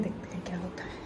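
breathy whisper into the kitchen. Real, not acted. Baby asleep, hushed.

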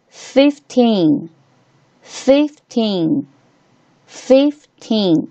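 put-on fifteen split slowly, teen drawn out and falling; stress on TEEN. Shown as a demonstration. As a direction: say fifteen leaning on the final teen. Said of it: "T E N这个部分是必须要被重".

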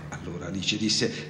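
ordinary delivery

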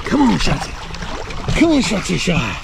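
loud high call across the beach. Real, not acted. Owner calling her dogs Shotzi and Shy back from the water, loud call. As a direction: shout the dogs' names to bring them back.

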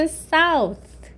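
"south" drawn out, ending on a th; th pronunciation. Shown as a demonstration. Teacher models the English word for south, careful th pronunciation. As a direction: say "south" with a voiceless final th. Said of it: "티에이치 발음 어떻게 나?".

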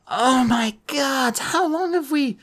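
high, slow, stunned voice; dawning horror. A narrator's reading. Annabeth snaps out of the casino's spell, horrified. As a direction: draw it out in high-pitched shock. She realizes they are trapped.

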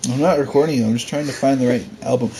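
ordinary delivery

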